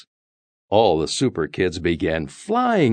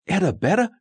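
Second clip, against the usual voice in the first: calling out; 13 semitones higher, faster than usual. A narrator's reading. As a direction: call for a hidden friend. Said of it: "Sal called".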